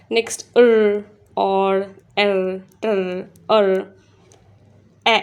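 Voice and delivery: slow sounds र, एर, टर, अर spoken one by one; slow phonics sounds; phonics drill. Shown as a demonstration. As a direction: drill each sound clearly for children. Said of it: "phonics sound drill".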